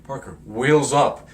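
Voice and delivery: loud, slow principal command; stern order. Retold or imitated. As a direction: bark it down the hall.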